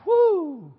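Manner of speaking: high, loud "woo" sliding down; acted high whoop of amazement; admiring whoop. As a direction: whoop like an impressed onlooker. Imagined admirer whooping at Bible knowledge.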